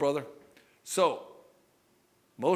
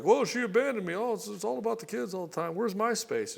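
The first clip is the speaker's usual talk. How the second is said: whiny husband; 8 semitones higher than usual. Retold or imitated. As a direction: complain like a neglected man-child. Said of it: "The guys are like".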